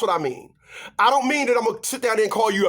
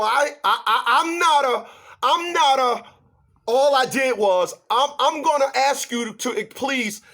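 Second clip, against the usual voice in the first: flustered stammer; 7 semitones higher, 3 dB louder than usual. Retold or imitated. Flustered retort retold.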